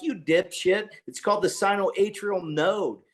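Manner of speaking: excited, exasperated explanation; getting worked up. Retold or imitated. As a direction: set them straight, pitch climbing with excitement. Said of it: "Sorry, I'm getting a little excited".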